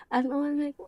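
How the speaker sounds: usual speaking voice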